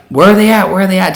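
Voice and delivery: high, loud retold shout; urgent calling out. Boyfriend asking the skydiving guy where his girlfriend is, urgent shout.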